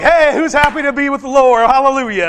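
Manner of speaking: loud, high, bright hallelujah call; cheery wake-up call. Retold or imitated. Preacher cheerfully waking grumpy campers at camp, loud and high. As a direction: rouse sleepy campers with joy.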